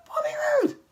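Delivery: "Bobby Roode" cried out high in disbelief; a high, pained yell of "Bobby Roode"; exasperated cry. Real, not acted. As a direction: wail the name in frustration. Rants about Bobby Roode's booking.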